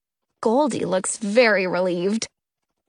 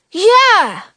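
excited agreement; 9 semitones higher, 8 dB louder than usual. A narrator's reading. Jonah backs up.

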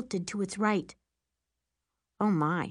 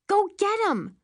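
high, eager cheer; excited urging; 13 semitones higher, 4 dB louder than usual. Acted. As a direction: egg her on, thrilled. Urging mom to chase bus.

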